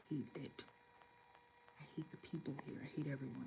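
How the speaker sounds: near-whispered loathing; confiding hatred under the breath; hushed venting. Real, not acted. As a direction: keep it down so nobody overhears the hate. Venting, not wanting to be overheard.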